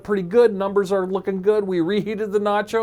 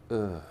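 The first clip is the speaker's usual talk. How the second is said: low, soft self-disgusted ugh; disgusted groan; 11 semitones lower, 11 dB quieter than usual. Retold or imitated. Host regretting saying he has a podcast, groaning. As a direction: groan at your own words. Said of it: "I go".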